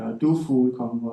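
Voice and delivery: plain reading voice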